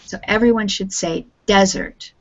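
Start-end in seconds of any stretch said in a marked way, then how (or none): 1.5-2.1 "desert" as DEZ-ert; falling "desert", weight on the opening syllable; stress on first syllable. Shown as a demonstration. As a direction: model "desert" clearly with the beat on its first syllable. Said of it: "stress on the first syllable".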